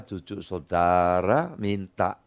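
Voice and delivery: ordinary talk, nothing marked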